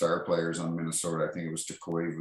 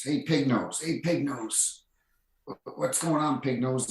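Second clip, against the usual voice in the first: higher, sing-song taunting; mocking taunt; 7 semitones higher than usual. [name] taunting Tyler Kennedy, higher teasing sing-song. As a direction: needle him with a teasing lilt.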